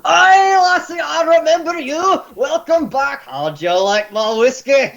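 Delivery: high, loud hearty Scots innkeeper; O'Toole's voice. Acted. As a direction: boom out a jolly Scottish barkeep greeting. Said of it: "He sees you and says".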